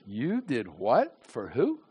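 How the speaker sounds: low, soft, slow disbelief with swooping rises; incredulous questioning. Retold or imitated. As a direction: question the boast quietly, drawing out each word. God questions his boast, acted.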